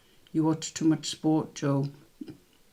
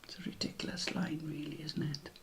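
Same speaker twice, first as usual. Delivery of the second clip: softer, quick and whispered; whispered aside; 12 dB quieter, faster than usual. Real, not acted. Quizmaster whispering a comment about the quote. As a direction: mutter it to yourself.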